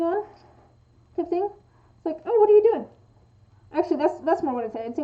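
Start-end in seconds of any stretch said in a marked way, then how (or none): 2.3-2.9 high, loud, curious demo question; interested. Shown as a demonstration. Showing someone interested in what you work on, interested. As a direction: pipe up with casual curiosity, words blended. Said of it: "implies that someone is interested".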